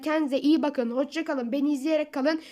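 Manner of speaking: bright sign-off to viewers; upbeat channel goodbye; youtuber outro. Real, not acted. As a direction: wrap up the video for fans.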